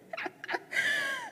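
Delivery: a high, squeaky burst of real laughter; high squealing laugh. Real, not acted. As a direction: let a shrill giggle escape. Laughing at camera operator.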